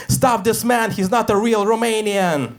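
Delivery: mock scream. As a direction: raise the alarm, half-hammed.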